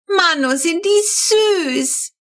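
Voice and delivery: put-on an enthusiastic child bursting out over the cute babies; thrilled shout. A narrator's reading. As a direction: squeal it like a delighted little girl. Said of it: "brüllt die Kurze, total begeistert".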